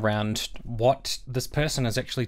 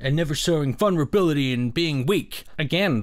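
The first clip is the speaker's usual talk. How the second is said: normal talking voice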